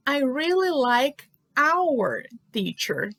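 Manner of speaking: slow, spaced-out teacher's example; slow modelling. Shown as a demonstration. Teacher reads an example to English learners, slow modelling. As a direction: read it out slowly, word by word.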